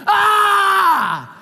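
loud high mock scream; frustrated yell. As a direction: let out a hammed-up wail of despair. Frustrated cry at horrible game.

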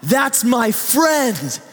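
put-on high, slow child's voice full of pride; proud child. Child telling mom about the volunteer, high slow and proud. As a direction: announce it like a kid showing off a friend. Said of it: "points and says".

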